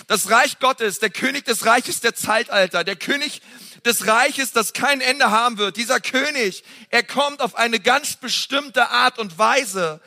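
high, intense sermon voice building up; fervent preaching. Acted. Pastor to congregation on Jesus the King, fervent preaching. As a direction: preach it with rising fire.

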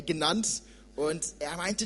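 ordinary delivery

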